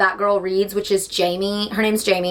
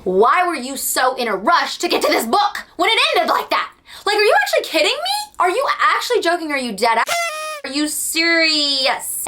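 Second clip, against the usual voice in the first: outraged disbelief; 7 semitones higher than usual. Retold or imitated. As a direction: pitch jumps way up in disbelief. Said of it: "I said".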